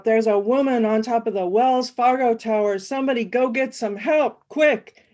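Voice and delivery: loud, rising panicked call for help; blaring alarm. Acted. Guy blaring at the diner crowd, loud and urgent. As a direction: yell the alarm across the diner. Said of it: "blares out".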